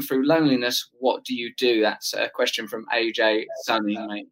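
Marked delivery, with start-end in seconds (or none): none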